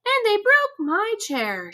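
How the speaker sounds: small high little bear voice; Baby Bear. Acted. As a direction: pitch it way up like a tiny cub. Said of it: "said Baby Bear".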